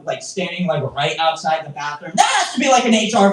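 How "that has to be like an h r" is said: high-pitched burst of indignation; comic outrage. Pretend. Comic objecting to bathroom lurker, outraged squeal. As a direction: jump way up in protest.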